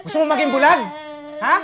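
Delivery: loud, tearful demand; furious father, close to tears; angry shout. Acted. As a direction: shout the question in his face. Father shouting at crying child.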